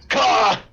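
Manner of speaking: loud, high raven caw. Acted. Game master voicing the raven on a backpack, loud cawing. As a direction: squawk out one sharp caw like a raven.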